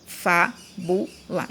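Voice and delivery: Fábula slowly split as FÁ, BU, LA; syllable by syllable. Shown as a demonstration. Teacher separating the little pieces, Fábula syllable by syllable. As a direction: pause between each syllable of Fábula. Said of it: "você vai separando os pedacinhos".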